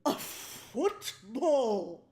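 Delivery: slow, loud, stretched-out announcement; drawn-out shout. Pretend. Streamer hamming up a football pickup, slow loud shout. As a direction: belt it out slowly like a grand reveal. Repeats the ham reveal.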